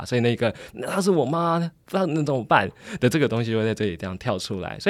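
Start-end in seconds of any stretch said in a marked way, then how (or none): 0.7-2.7 imitating a boyfriend's high-pitched protest; helpless excuse. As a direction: plead helplessly, lightly acted and pitched up. Boyfriend voiced using mother as shield. (模仿男友无奈辩解)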